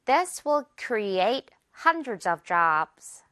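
This will create hundreds of jobs, word by word, very slowly; slow teaching read; very slow model. Shown as a demonstration. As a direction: spell the sentence out slowly for learners. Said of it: "문장 전체 듣고 따라해주세요".

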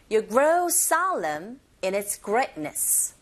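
slow model line, stretching "grow solemn" and "greatness"; content words stressed. Shown as a demonstration. Teacher leads repeat-after-me, slow with "grow solemn" and "greatness" stressed. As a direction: lean on "grow solemn", pass lightly over "in its". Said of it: "머물지 않고 빨리 지나가면서".

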